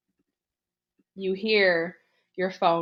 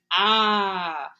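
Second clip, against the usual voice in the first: long, loud mock scream "ah"; half-acted drawn-out scream; victim's mock scream; 6 dB louder than usual. Victim sees the killer with knife, mock scream. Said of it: "And you're like".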